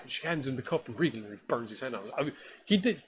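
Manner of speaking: ordinary talk, nothing marked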